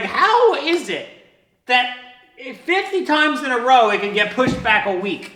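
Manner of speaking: high, loud, stretched disbelief; hammed-up astonished question; mock incredulity. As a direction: marvel loudly at the absurdity, pausing for effect. Exaggerated disbelief at his own delays.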